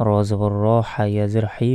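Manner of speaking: ordinary talk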